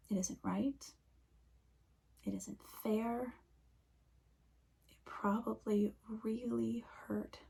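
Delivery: soft, whispered lament; hushed grief. Acted. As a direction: breathe the words out gently. Echoing lament for Jesus.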